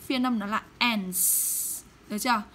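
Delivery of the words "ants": "ants" slowly, ending in a crisp ts. Shown as a demonstration. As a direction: drag out "ants" and clip the ts. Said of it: "cô biến nó thành số nhiều thì cô sẽ".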